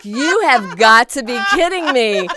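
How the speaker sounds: can't believe it, laugh it out; laughing disbelief. Retold or imitated. Disbelief at mom's request.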